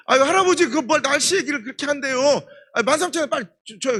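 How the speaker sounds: high, loud, impatient Gyeongsang haggling; pushy haggling customer. Retold or imitated. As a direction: press the old man to sell, loudly.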